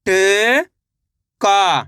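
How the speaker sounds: normal talking voice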